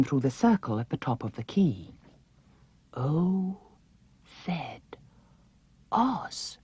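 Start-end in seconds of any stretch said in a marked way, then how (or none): 2.9-6.6 softly spelled out in wonder, barely voiced; hushed awe, dropping to almost nothing on the Z; awed whisper. A narrator's reading. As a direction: breathe each letter as the memory dawns. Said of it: "whispered Dorothy".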